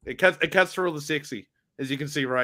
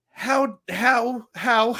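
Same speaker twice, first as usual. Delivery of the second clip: baffled; 7 semitones higher, much slower than usual. Retold or imitated. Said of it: "I just remember going".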